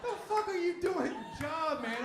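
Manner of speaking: slow, loud and high disbelief; drawn-out outraged question; incredulous yell. Retold or imitated. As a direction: demand it slowly, outraged. Incredulous outburst at employers.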